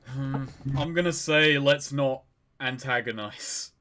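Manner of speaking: usual speaking voice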